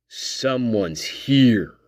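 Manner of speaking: low, soft frantic murmur; hushed talk. Retold or imitated. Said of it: "it was like talk".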